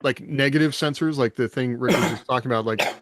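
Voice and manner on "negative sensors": ordinary talk, nothing marked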